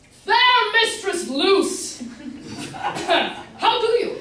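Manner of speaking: high, loud, slow and drawn out with a laugh; hammed-up gallant greeting. Suitor greeting mistress Luce, hammed-up and high. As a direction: overdo the courtly wooing.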